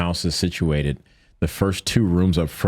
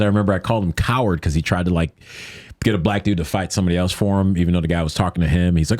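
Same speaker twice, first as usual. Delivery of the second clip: ordinary delivery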